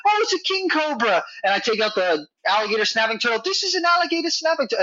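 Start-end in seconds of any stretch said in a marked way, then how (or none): 0.1-1.2 put-on high, eager little British boy; imitating a high little British boy; British kid voice. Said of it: "he's a little British kid". 3.4-4.8 put-on high, quick little British boy; imitating a high child with a British accent; British kid voice. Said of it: "he's a little British kid".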